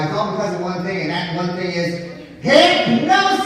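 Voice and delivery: showman builds to a big shout on hypnosis; building to a shout. Acted. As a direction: build the suspense, then yell hypnosis long and loud.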